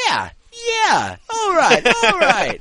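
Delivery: high, loud excited yelps; Andrew impression. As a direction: mimic a friend's over-eager catchphrases. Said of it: "why Andrew insists on saying".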